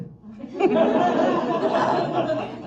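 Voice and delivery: high burst of laughter; laughing. Real, not acted. Comic laughing at her copay joke, bright burst. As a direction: break into a laugh.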